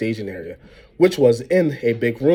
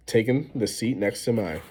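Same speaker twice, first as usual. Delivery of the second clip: usual speaking voice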